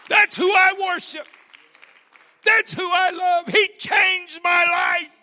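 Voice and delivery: very high, loud ringing cry; full-force preaching shout. Real, not acted. Preacher to congregation, full-force high shouted worship. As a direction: shout your praise to the rafters.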